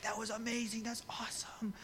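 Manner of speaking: hushed, high mock awe; breathy put-on amazement; mock excitement. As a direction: gush softly, nearly whispering. Acts out post-Easter excitement.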